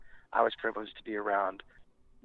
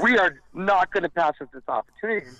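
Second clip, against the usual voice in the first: emphatic insistence; 7 semitones higher, 6 dB louder than usual. Retold or imitated. Retells bandmate insisting on the drink.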